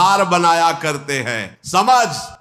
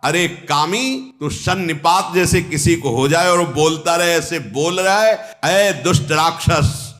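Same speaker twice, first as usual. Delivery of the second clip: fierce denunciation; 6 semitones higher than usual. Acted. Angad cursing Ravan as thief and sinner, fierce denunciation.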